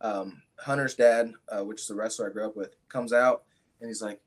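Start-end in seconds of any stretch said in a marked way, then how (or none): none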